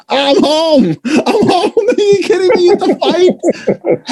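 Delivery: high, laughing delight; giddy high-pitched joy breaking into laughter; overjoyed. Retold or imitated. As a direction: burst out like you finally belong. Overjoyed at finding yeshiva debate.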